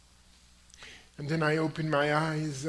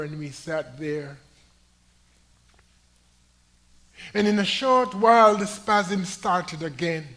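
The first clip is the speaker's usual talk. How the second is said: loud ringing pulpit proclamation; full-throated sermon climax; preaching at full force; 4 semitones higher, 9 dB louder than usual. Acted. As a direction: lift the story to the back pews. Preacher building the testimony.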